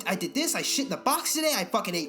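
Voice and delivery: hammed-up cheerful dog bragging about its day; hyper happy dog. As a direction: bounce through it like an overexcited dog greeting its owner. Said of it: "someone's just like".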